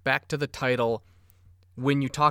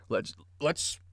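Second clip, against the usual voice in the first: choking on coffee mid-word; strained, caught on a swallow of coffee; choking on a drink; 5 dB quieter than usual. Real, not acted. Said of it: "choking on my coffee".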